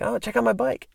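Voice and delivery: high, proud show-off line; proud Larry. Retold or imitated. Larry showing Chris his BMX bike, high and proud. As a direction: brag a little about the new ride.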